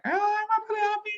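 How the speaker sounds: very high, fast squeal of joy; high squishy happy voice; squeaky excited voice. Shown as a demonstration. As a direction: squeal it like an overexcited person. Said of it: "when they get excited, they sound like".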